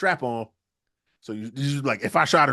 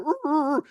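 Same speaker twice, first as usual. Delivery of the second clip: much higher choked rrr sounds; muffled gargling groan; 13 semitones higher than usual. Acted. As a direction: groan through a gun barrel. Dad with shotgun in mouth.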